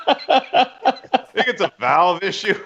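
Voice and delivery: amused imitation of bluffed expertise; trying to sound smart. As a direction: drag it out like a pretend know-it-all. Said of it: "try to sound smart".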